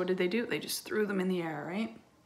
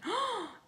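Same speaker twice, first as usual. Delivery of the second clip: high acted gasp; suspenseful intake of breath; startled gasp; 13 semitones higher, 3 dB quieter than usual. A narrator's reading. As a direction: gasp as the door opens. Door opens suddenly.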